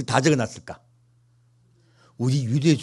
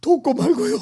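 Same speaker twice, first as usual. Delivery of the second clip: high, eager promise; eager Peter. Retold or imitated. Peter eagerly promising Jesus his help, high eager voice. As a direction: answer like a keen disciple. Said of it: "이렇게".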